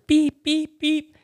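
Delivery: high beeping imitation of a PC speaker; mock computer beeps. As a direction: beep it out like an old motherboard. Imitating old motherboard sound.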